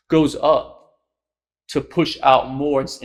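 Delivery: ordinary talk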